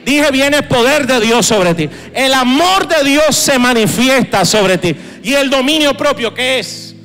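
loud, ringing pulpit proclamation; preaching at full force; fervent declaration. Real, not acted. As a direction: declare it over the congregation.

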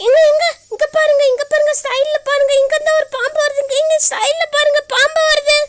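high, loud, urgent alarm; panicked warning. Acted. Woman warns man of a snake at the side, panicked. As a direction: shriek the warning in a rush of panic.

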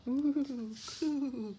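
high, loud drawn-out coo; gentle dove call. A narrator's reading. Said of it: "called the dove gently".

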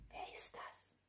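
whispered, barely audible question; hushed suspense. A narrator's reading. Narrator to listeners after the knock at the door, whispered. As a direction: whisper it as if afraid to be heard.

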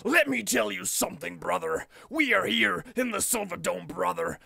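raspy hyped wrestler promo, brother; lightly done Hogan growl; Hulk Hogan impression. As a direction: channel Hogan cutting a promo. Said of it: "he's like".

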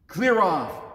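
big, loud warning; booming command. Acted. Cloaked man scares off the thieves, booming. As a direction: order the thieves off with force. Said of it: "boomed a huge voice".

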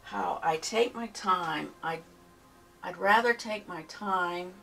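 ordinary talk, nothing marked

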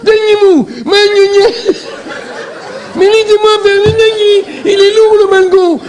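slow, high, choked voice; Roméo squeezed by the balcony railing; high strained Roméo, throat squeezed by the railing; strangled Roméo. Acted. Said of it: "il parle comme ça".

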